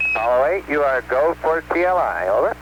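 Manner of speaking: crackly radio transmission; like a capcom over the radio; astronaut radio. Real, not acted. Mission control radio call.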